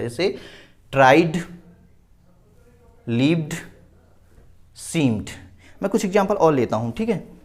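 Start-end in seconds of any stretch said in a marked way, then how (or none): 0.9-5.3 ट्राइड, लिव्ड, सीम्ड one at a time, each closed with d; final D sound. Shown as a demonstration. Teacher listing examples of ED read as D, final D. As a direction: isolate ट्राइड, लिव्ड, सीम्ड with pauses between. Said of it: "डी से ही आता है".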